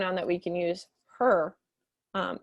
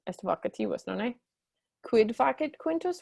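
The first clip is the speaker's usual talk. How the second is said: ordinary talk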